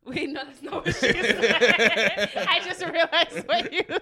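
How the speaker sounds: loud, slow laughing words; laughing realization. Real, not acted. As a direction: crack up mid-sentence.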